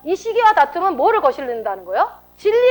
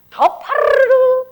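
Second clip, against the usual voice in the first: high, loud, drawn-out rolled r; flaring-up flutter; 11 semitones higher, 5 dB louder, much slower than usual. Acted. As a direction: trill it high like a temper flaring. Mimics temper flaring up. (파르르 높고 길게 떨듯이)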